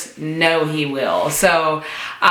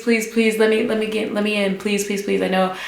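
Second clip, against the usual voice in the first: high, rapid pleading; retold desperate little pleas, pitched up; begging; 4 semitones higher, much faster than usual. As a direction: beg over and over, words tumbling out. Acting out begging to get in.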